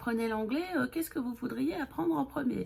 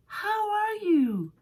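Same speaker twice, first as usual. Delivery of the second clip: much higher, very slow question; exaggerated English question; teacher question; 11 semitones higher, 4 dB louder, much slower than usual. Shown as a demonstration. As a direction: sing it slowly like a teacher. Teacher asks model question.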